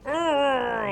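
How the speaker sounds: high, loud mock throwing grunt; imitated grunt. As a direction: grunt like hurling someone. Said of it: "He made a loud".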